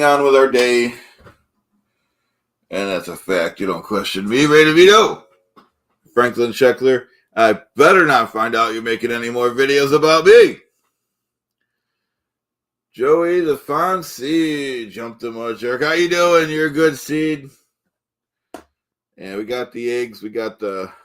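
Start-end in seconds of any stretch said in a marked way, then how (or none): 3.6-10.6 mock threat. Host threatening rival Franklin Scheckler, loud mock menace. 12.9-17.5 higher, slow, put-on voice; drawn-out silly greeting; goofy greeting. Host greeting viewers in a goofy put-on voice.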